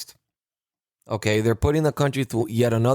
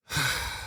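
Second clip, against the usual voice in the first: long, weary exhale; heavy sigh; 8 dB quieter than usual. Real, not acted. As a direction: let out a real, frustrated sigh. Pauses after naming his anger.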